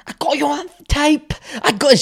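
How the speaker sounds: high, loud put-on shout; triumphant mock accusation. She runs after him with the phone, hammed-up triumphant shout. Acted line of woman chasing man.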